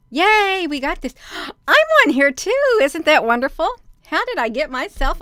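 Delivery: high, loud squeal of delight; thrilled gasp at seeing herself. Real, not acted. Delighted to see herself onscreen.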